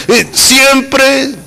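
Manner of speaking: usual speaking voice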